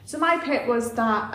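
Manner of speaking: ordinary talk, nothing marked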